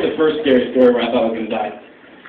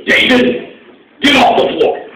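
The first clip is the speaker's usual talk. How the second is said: lightly voiced old father, higher and louder; old dad's voice; 10 semitones higher, 4 dB louder than usual. Elderly father telling his son off in the store, lightly voiced. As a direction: scold a grown son like a tired dad. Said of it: "seventy-year-old man go".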